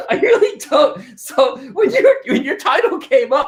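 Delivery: words squeezed out through high laughter; giggling while admitting he isn't lazy; laughing. Real, not acted. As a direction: laugh as you talk. Host laughing through his words.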